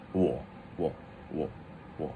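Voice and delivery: 我 short, low and soft; natural third tone. Shown as a demonstration. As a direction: drop 我 low like an offhand yeah. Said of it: "saying a third tone".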